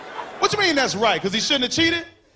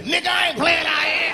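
mad homeless man; 8 semitones higher than usual. Acted.

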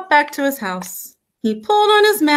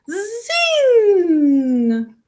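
high, long zing gliding down; springy zing; 7 semitones higher than usual. A narrator's reading. Narrator as the spring unwinds, high ringing zing. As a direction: let it fly high and stretch it.

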